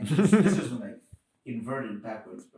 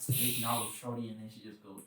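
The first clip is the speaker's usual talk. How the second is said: ordinary talk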